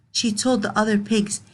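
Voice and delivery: plain reading voice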